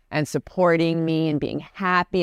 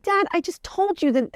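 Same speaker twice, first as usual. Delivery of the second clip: much higher, fed-up whine; exasperated daughter. Retold or imitated. Daughter exasperated with her dad, much higher. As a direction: roll your eyes at dad, pitched way up.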